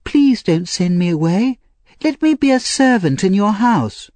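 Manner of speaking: put-on high, anxious child's plea; pleading Oliver. A narrator's reading. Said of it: "cried Oliver".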